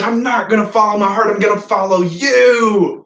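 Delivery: high, loud acted declaration; shouted vow. Peter vowing to follow Jesus, shouted. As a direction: shout the promise, stretching the final word.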